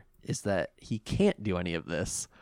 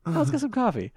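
high cheery voice; faster than usual. Pretend. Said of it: "continuing Yugi bit".

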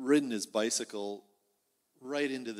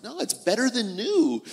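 insistent dad; 11 semitones higher, 10 dB louder than usual. Acted.

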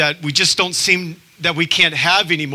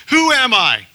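mock question; 10 semitones higher, 4 dB louder than usual. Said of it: "he says to the audience".

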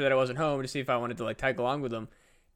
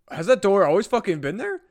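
alarmed; 6 semitones higher, 7 dB louder than usual. Retold or imitated. Roommate's alarmed question about door.

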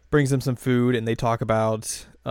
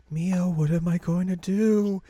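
higher, slow, hammed-up despair; mock distraught Yui; 6 semitones higher, slower than usual. Quoting Yui's fake despair to Mio, high and slow. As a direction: whimper it like all hope is lost. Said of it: "Yui's just like".

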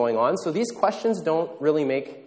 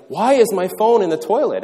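half-hearted angry dad, higher and louder; a hint of a fed-up father shouting; mock angry parent; 6 semitones higher, 5 dB louder than usual.

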